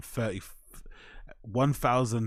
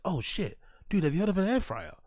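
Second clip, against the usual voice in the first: higher-pitched mock excitement; mock amazed discovery; 8 semitones higher than usual. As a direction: imitate someone thrilled by a stale discovery. Acting his sudden air fryer discovery.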